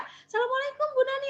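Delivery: high-pitched acted greeting from a passing car; friend calling out. Friend greets her from car window. (sapaan teman bernada tinggi, menirukan)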